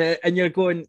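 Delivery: usual speaking voice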